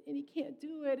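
ordinary talk, nothing marked

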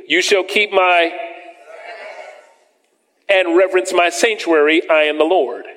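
preacher reading scripture aloud to the church. Acted. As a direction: proclaim the verses from the pulpit. Reads scripture in raised voice.

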